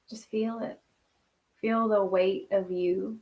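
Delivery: soft, hushed meditation voice; a quiet spiritual breathing guide; guided meditation. Acted. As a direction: guide them into calm, breath by breath.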